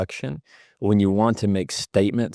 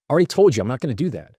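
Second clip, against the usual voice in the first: very fast, higher, run-together retort; rushed, pitched-up snap; grasping for control; 5 semitones higher, 3 dB louder, much faster than usual. Shown as a demonstration. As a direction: blurt it out defensively in one rush. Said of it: "one sounds like it's grasping for control".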